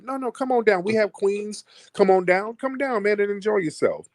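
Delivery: sing-song high camp invitation, hammed up; camp queen voice. Host teasing a caller, inviting him down in a high camp voice. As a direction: flounce the welcome like a mock drag queen.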